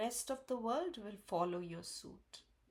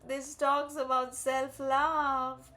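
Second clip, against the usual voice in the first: laughing; 5 semitones higher, 8 dB louder, slower than usual. Real, not acted. Laughing on seeing the card.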